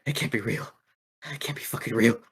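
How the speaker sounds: soft shocked mutter; a hint of a shaken fighter; stunned disbelief. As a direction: Vamp quietly stunned at losing. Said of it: "and he goes".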